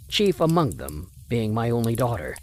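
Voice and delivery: normal talking voice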